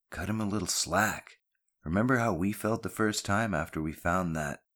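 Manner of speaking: ordinary delivery, nothing marked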